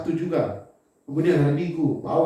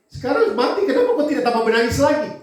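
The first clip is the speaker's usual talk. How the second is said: loud, laughing mock question; laughing incredulity; 7 semitones higher, 5 dB louder than usual. Onlookers puzzled that David stopped weeping, laughing. People mocking David's behaviour.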